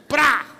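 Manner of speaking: loud, high explosive noise; imitated splat. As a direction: blurt out a sudden comic splat. (barulho explosivo imitado)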